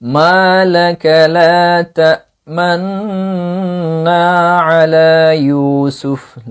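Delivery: cantillated Quranic recitation with ishmam. Shown as a demonstration. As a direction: recite it slowly as in tilawah, lips rounding on تأمنا. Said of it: "প্রথমে আমরা ইসমা অনুযায়ী তেলাওয়াত করি".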